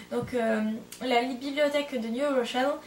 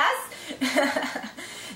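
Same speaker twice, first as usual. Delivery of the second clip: spontaneous chuckling laugh; real laugh. Real, not acted. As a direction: burst of amused laughter. Teasing thanks to a friend.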